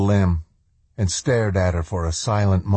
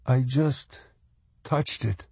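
higher, soft, hesitant; young woman's voice; 5 semitones higher, 5 dB quieter than usual. A narrator's reading. Swan answering Josh, soft and hesitant.

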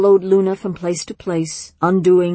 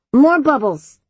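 put-on high, louder gleeful little girl; excited child; 7 semitones higher, 4 dB louder than usual. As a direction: demand it like a thrilled kid. Child demanding more bubbles.

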